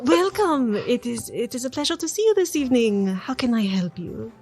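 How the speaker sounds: a hammed-up bad French accent; a lightly put-on French hostess accent; terrible French accent. Bartender greeting the party in a mock French accent. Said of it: "who I was going to try to have a terrible French accent".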